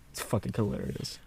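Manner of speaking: normal talking voice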